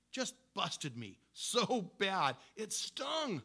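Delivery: slow, stretched words, voice cracking into a whisper; pained emphasis. Real, not acted. Preacher to congregation, pained and stretched. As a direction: ache through every word. Pained recounting of being rebuked.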